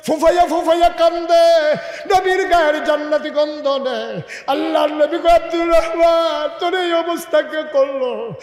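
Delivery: high, loud, emotional sermon cry; impassioned preaching. Acted. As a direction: pour out the reunion at full voice. Preacher tells tearful embrace.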